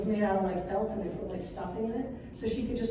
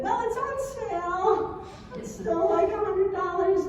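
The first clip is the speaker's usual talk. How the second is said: higher, half-sung mock dad; imitating dad; 8 semitones higher than usual. Mom mimicking dad on the phone, lilting and higher. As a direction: mimic the husband with a lilt.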